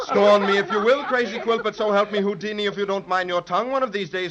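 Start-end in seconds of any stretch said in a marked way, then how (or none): 0.0-1.0 laughing. Acted. Magician laughs off insult.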